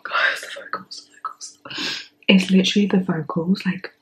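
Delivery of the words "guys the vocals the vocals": breathy whisper, fading away; hushed, stunned murmur; overwhelmed whisper. Real, not acted. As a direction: whisper it, too moved to speak. Overwhelmed by the vocals.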